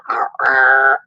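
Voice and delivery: low growling dog imitation; low mock-monster dog growl. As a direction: growl like a grumpy little dog. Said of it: "he sounds like he's a monster".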